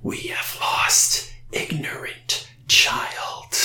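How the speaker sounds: slow eerie creature whisper; creepy whisper. Acted. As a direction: hiss it slowly like an ancient spirit.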